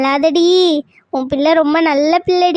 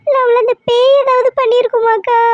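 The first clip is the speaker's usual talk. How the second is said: tearful fear; 4 semitones higher than usual. Acted. Mother begging the neighbour about her missing daughter, crying. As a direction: voice breaking into a sob, pitched up.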